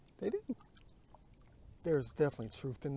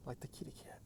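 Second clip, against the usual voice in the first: whisper; 11 dB quieter than usual. Real, not acted. Hushed aside while filming.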